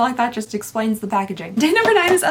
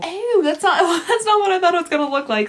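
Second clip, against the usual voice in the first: laughing disgust; 6 semitones higher, 3 dB louder than usual. Real, not acted. Reacts to serum look.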